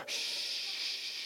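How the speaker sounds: long, drawn-out "shh"; lulling hiss of a shush; long soothing shush. Shown as a demonstration. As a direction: hush a baby with one steady shush. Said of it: "And then you just go".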